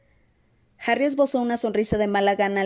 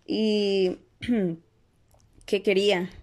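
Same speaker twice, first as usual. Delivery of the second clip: offhand question with a small throat-clear; feigned indifference; much slower than usual. A narrator's reading. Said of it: "preguntó Ron fingiendo indiferencia".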